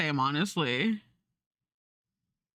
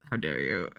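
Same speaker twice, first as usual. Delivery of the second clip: mock offended mom. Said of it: "She's like".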